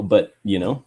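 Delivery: ordinary delivery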